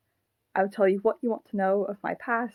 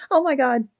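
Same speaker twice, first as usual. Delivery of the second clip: loud, high gasp of horror; horrified; 7 semitones higher, 9 dB louder than usual. Acted. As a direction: cry out at what you have just done. Puppet realises it is choking Mike.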